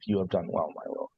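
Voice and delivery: ordinary talk, nothing marked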